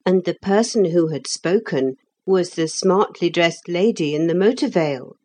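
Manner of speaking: plain reading voice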